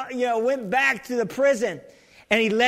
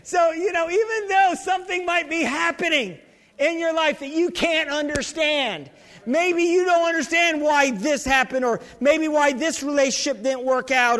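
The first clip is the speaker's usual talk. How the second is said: raised pitch, emphatic sermon delivery; fired-up preaching; 4 semitones higher than usual. Real, not acted. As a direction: preach with rising fervour to a congregation. Preaching to congregation.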